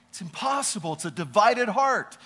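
ordinary delivery

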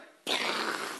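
explosive mouth noise of a crash; mimicked door-bashing sound; imitated door kick. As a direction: burst of breath for a door kicked open. Imitates kicking the door open.

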